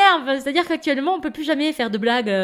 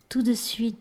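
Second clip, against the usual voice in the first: ordinary delivery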